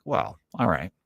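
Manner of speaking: soft, low concession; instantly deflated, quietly giving way; meekly backing down. Acted. Line-stander told the cutter is a pilot, backing down. Backs down on hearing he's pilot.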